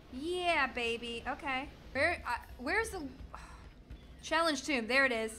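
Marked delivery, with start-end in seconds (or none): none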